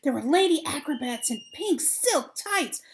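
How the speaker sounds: usual speaking voice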